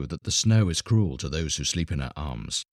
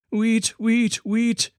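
very high little bird chirps; twittering linnets; much slower than usual. A narrator's reading. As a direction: tweet it like tiny songbirds. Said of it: "twittered the green linnets".

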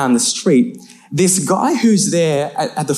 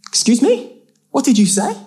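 high, affronted challenge; confrontational stranger; 10 semitones higher than usual. Retold or imitated. Stranger challenges two cousins on the street, high and affronted. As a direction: question them in a raised, challenging pitch.

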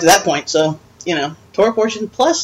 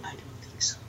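whispered aside; 20 dB quieter, faster than usual. Real, not acted. Aside, comic refusal.

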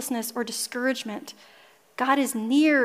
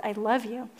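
mouthed; 7 dB quieter than usual. Retold or imitated. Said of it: "he mouthed".

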